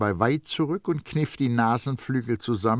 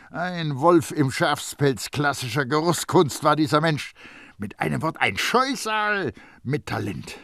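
higher, forceful denunciation of a rival; heated, scornful storyteller; indignant tirade; 7 semitones higher, 5 dB louder than usual. A narrator's reading. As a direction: rant about the scoundrel's skill.

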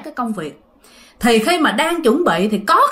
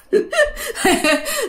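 high laughing fit; 14 semitones higher than usual. Real, not acted. Teller laughs.

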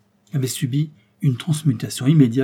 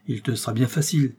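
usual speaking voice

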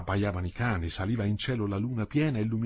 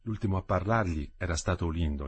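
ordinary delivery, nothing marked

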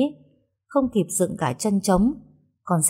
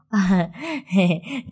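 short sheepish laugh to cover shame; a little hee-hee of embarrassment; embarrassed giggle. Acted. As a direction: giggle it off awkwardly. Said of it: "cười hề hề".

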